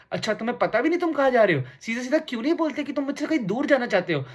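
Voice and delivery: high-pitched, suspicious wife's interrogation; mock wounded wife, pitched high; nagging wife impression. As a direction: mimic a wife pressing her accusation. Wife grilling husband, acted quote.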